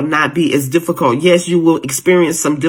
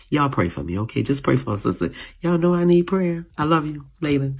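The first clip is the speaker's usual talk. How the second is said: a choked-up preacher asks her viewers to pray, softly emotional; emotional and quiet; 7 dB quieter, faster than usual. Real, not acted. Tender, hushed sign-off. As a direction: softly, voice sinking after tears. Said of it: "sorry I got all emotional".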